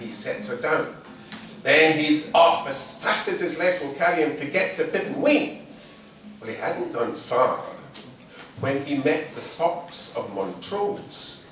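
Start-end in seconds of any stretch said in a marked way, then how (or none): none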